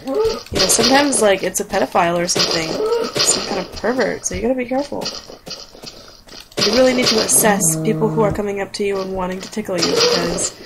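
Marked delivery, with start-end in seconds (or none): none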